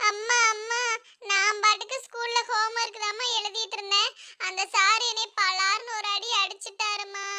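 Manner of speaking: soft mock-crying child voice; tearful son. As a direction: whimper a complaint to mother, quietly. (அம்மாவிடம் அழுதுகொண்டே புகார் சொல்லும் சிறுவன்)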